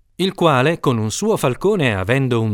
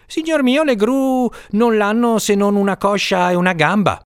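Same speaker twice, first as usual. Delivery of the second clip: higher, hurried servant voice; quick servant's reply; 8 semitones higher than usual. A narrator's reading. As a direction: blurt it out fast like a lying cook. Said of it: "subitamente rispose".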